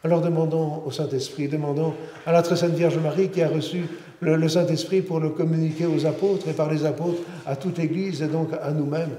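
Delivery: usual speaking voice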